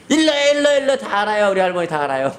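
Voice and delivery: put-on very high, sing-song kid's taunt; high child taunt. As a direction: tease him like a triumphant little kid.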